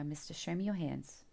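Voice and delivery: ordinary delivery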